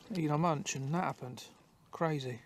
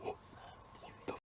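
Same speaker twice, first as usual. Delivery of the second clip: whispering; 18 dB quieter than usual. Real, not acted. Sudden noise in dark woods.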